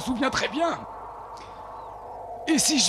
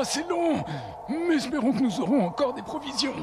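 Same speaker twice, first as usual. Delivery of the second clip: ordinary delivery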